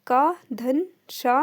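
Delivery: slow, deliberate, each part separated; teacher breaking the conjunct into parts slowly; slow spelled-out breakdown. Shown as a demonstration. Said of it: "वो ता और रा से बना होता है".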